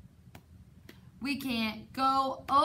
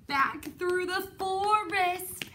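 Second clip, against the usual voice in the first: panicked alarm; 8 semitones higher than usual. Acted. Reader playfully panics as the bear chases them home. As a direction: cry out like the bear is right behind you.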